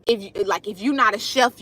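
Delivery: usual speaking voice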